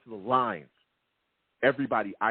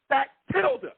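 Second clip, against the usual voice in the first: high, louder burst of anger; furious outburst; 4 dB louder than usual. Real, not acted. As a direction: let the frustration explode. Host furious at referees.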